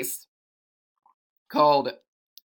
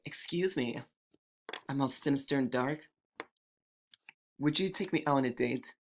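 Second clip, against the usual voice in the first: soft, sinister come-on; quiet, dark demon flirting; seductive female demon; 9 dB quieter than usual. Acted. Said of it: "the seductive female demon".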